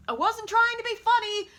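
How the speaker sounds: high, mock-offended kid protest; retold huffy outburst, high pitched; indignant protest. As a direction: protest like a child being laughed at. Retold protest to laughing sister.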